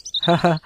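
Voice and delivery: brief two-beat laugh; light "ha ha" before answering; short chuckle. Acted. Fish farmer answers his friend's greeting with a short chuckle. Friend asked how farming goes.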